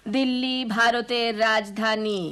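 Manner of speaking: slow, syllable by syllable classroom chant; teacher setting the line for pupils to echo; slow recitation. Shown as a demonstration. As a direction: say it slowly for the class to repeat. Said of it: "আমার সাথে সাথে বলো".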